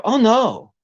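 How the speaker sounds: relaxed biker guy explaining why they came; lightly voiced rocker dude. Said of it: "She goes".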